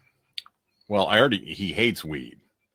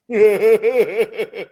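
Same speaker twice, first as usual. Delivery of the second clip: big burst of laughter, pitched up; high loud laugh; 12 dB louder than usual. Real, not acted. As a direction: crack up loudly at own joke. Laughing at own answer.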